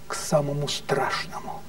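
a dark whispered hint of horror; hushed whisper. Storyteller approaches the most terrible.

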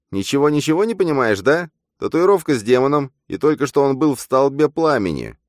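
high, loud, excited character voice; high-pitched pushy sidekick; exasperated double. Acted.

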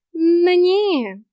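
high, loud, drawn-out cat dismissal; reassuring cat. A narrator's reading. Cat reassures the heroine, drawling. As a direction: wave the worry away with a long drawl. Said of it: "успокоил меня Васька".

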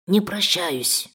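slow, cold parting threat; menacing thug voice. Acted. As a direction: drawl it out as a threat.

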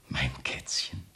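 soft sneering whisper; mocking whisper. Husband whispering mockingly about his frightened wife. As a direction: whisper it with cold mockery.

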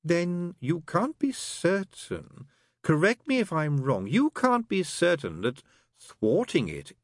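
sly. Acted. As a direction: lead him on slyly. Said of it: "Crowley looked up slyly".

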